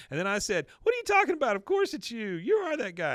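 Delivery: high, loud mock insistence; chatty wife hammering her point; insistent storyteller. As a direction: act out indignant disbelief.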